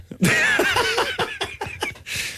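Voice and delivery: very high, rising laughing squeal; real high laugh. Real, not acted. Laughing at the trash joke, squealing. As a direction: burst out laughing.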